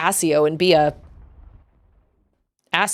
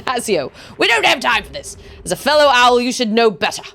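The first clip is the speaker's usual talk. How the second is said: reproving British officer voice; British accent; 5 semitones higher than usual. Acted. Officer chides fellow owl Asio, British accent. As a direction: chide a colleague in put-on British. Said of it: "I don't know why I'm doing British accents".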